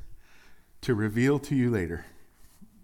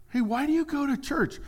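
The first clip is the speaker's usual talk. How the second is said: chatty friend asking; 13 semitones higher than usual. Acted. Pastor acts out churchgoer chat.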